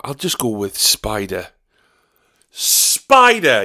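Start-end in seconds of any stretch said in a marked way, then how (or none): 2.5-3.6 higher, louder proud cry; mock triumphant shout. Mocking the contestant's wrong answer, loud. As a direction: announce it like a winner.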